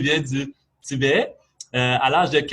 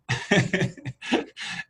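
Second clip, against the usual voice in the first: short, amused laugh; real chuckle; 7 semitones higher than usual. Real, not acted. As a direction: chuckle briefly at your own aside. Speaker laughs after naming Chicoutimi. (petit rire amusé)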